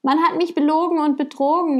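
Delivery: high-pitched, quick storybook wail; wailing lament. A narrator's reading. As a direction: lament it like a cheated man in a tale. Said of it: "jammerte er".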